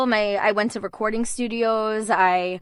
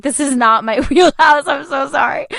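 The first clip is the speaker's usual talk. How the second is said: higher, embarrassed apology broken by a laugh; 4 semitones higher, 4 dB louder than usual. Retold or imitated. Actor apologizing to the casting director after auditioning, laughing. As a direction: retell an awkward apology, laughing through it.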